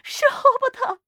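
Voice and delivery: piercing high, loud shriek; shrill scream. A narrator's reading. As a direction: scream it out, pitched right at the top. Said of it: "尖声叫喊". (激动地尖声大叫)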